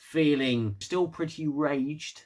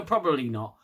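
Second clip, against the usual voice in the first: ordinary delivery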